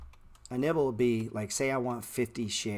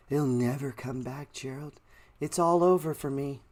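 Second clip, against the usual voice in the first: hammed-up wail rising louder; mock despair; 4 semitones higher, 4 dB louder than usual. Mocking a viewer who lost everything, hammed-up wail. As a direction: overplay the ruined trader. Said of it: "Don't talk like that".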